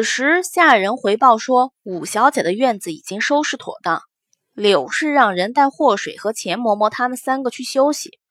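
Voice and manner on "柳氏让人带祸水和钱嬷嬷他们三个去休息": ordinary delivery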